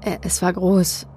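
halting, frightened stammer; slow, broken by hesitant uhs. A narrator's reading. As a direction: stumble and grope for each word. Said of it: "er begann zu stammeln".

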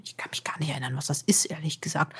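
hushed unvoiced whisper. Real, not acted. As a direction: drop to a whisper as an aside. Aside while reading old report.

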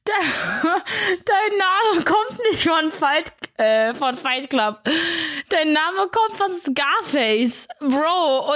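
high, slow laughing mom voice; imitating mom. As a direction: mom cracking up as she speaks. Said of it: "Sie so".

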